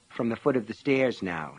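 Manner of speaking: usual speaking voice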